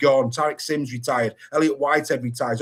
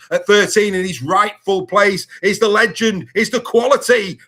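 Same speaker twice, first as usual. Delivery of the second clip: louder, higher big build-up for the legend; hyped announcement; 6 semitones higher, 7 dB louder than usual. Real, not acted. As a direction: announce him like a stadium MC. Hyping the star player.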